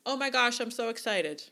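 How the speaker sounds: loud, high, gushing squeal of delight; excited sign-off. Shown as a demonstration. Wedding planner's email sign-off to clients, loud high gushing excitement. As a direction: sound thrilled to bits. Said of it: "adding in this little line at the end of your email".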